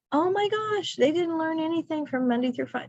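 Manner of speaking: high-pitched, hurried dismay; mock alarm. Teacher discovering kids didn't learn anything, high mock alarm. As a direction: act out a sudden panicked realization.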